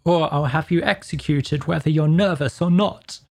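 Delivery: forceful, angry King; angry royal threat. A narrator's reading. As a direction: threaten the witness as an irate king. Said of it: "the king repeated angrily".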